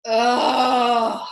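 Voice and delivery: loud shuddering groan of disgust; a cold, shuddering ugh; shivering ugh. A narrator's reading. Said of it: "said the Lory with a shiver".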